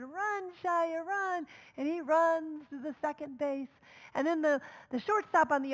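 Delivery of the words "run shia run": retold cheering. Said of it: "they were screaming".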